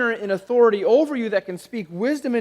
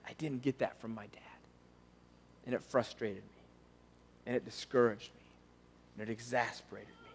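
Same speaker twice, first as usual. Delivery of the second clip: breathy near-silent whisper, much softer; hushed wounded confession; whispered inner thought; 8 semitones lower, 15 dB quieter than usual. Retold or imitated. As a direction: voice a listener's private hurt. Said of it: "you're thinking to yourself".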